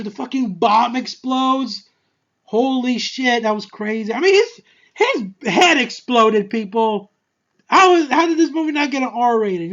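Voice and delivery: loud, high-pitched astonishment; amazed outburst. Real, not acted. Reviewer stunned by the bomb scene, loud and excited.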